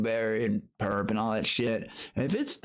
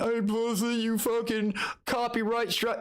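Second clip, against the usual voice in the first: lightly mocking taunt, higher and slow; mocking haters; 10 semitones higher than usual. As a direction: imitate the trolls sneering, a touch hammed-up. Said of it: "they call me".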